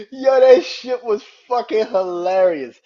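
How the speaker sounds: loud, high laughter into slow words; real laughing fit while talking; cracking up. Real, not acted. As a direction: gasp out words through laughter. Helpless laughter at the story.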